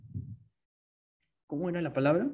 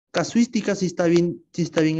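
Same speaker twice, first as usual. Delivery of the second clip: usual speaking voice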